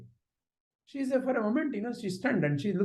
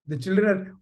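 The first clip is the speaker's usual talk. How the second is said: ordinary delivery, nothing marked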